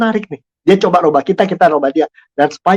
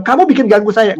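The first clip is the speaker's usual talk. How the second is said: blaming; 6 semitones higher than usual. Shown as a demonstration. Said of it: "Jangan bilang".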